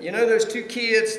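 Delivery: ordinary delivery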